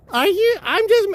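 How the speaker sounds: squeaky high gushing fan voice; starstruck fan. Retold or imitated. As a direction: gush like a starstruck lady fan. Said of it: "his one lady grabbed him and go".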